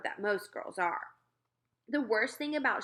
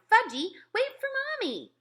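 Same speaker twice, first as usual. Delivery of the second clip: much higher, calling mother's yell; 14 semitones higher, 3 dB louder than usual. Acted. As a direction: chase the toddler down the path.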